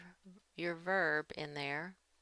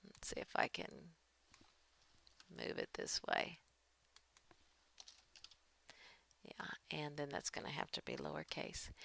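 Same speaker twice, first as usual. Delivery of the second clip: muttered whisper; 11 dB quieter than usual. Real, not acted. Muttering while fixing the document.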